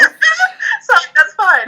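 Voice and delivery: high, loud laughing apology; laughing through a quick sorry. Real, not acted. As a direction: giggle the words out, amused. Laughing apology after awkward jump.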